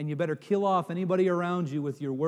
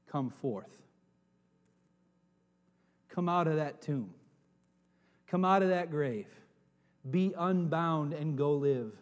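tender invitation; 2 semitones lower, 3 dB quieter than usual. Acted. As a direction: invite gently, close to the ear. The Lord calling us today.

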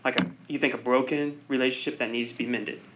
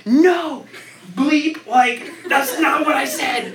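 high, loud, slower mock scolding dad; imitating dad scolding; 10 semitones higher, 5 dB louder, much slower than usual. Son imitating his dad scolding him over the wrong tools, high mock yell. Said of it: "He's like".

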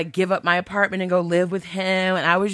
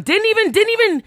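exasperated; 4 dB louder than usual. Real, not acted. Exasperated about the scammer's behaviour.

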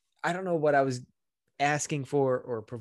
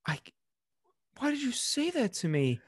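put-on high, wounded little-kid plea; milk the hurt to get out of trouble; feigned hurt; 11 semitones higher than usual. Calculating son plays victim to mother, feigned hurt. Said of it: "if I play this correctly".

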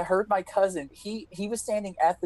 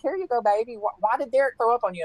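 a hint of grandma; 5 semitones higher than usual. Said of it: "she's like".

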